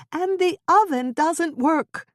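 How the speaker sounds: high, loud, slow wail through sobs; shrieking sob. A narrator's reading. Wife breaking down over the broken oven, sobbing shriek. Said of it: "she shrieked, shaking with sobs".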